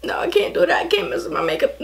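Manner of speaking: high, half-whispered laughing refusal; giggling, pulling herself together; laughing protest. Real, not acted. Stops herself crying, laughing.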